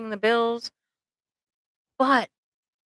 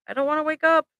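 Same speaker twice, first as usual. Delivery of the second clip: depressed; 8 semitones higher, much faster than usual. Retold or imitated. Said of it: "when I'm super depressed".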